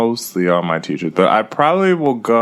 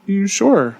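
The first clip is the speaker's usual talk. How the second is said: surprised; 10 semitones higher than usual. Retold or imitated. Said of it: "I was like".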